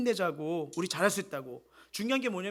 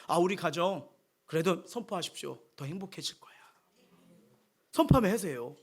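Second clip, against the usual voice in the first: normal talking voice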